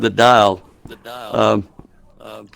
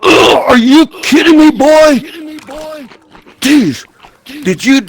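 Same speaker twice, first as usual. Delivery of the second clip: high, cracking exasperated father; imitating an outraged father; exasperated dad; 8 semitones higher than usual. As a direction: play the fed-up dad yelling up at the roof. Dad voiced scolding in story.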